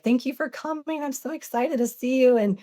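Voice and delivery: put-on high, gushing excited little kid; really excited. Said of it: "she would get really excited".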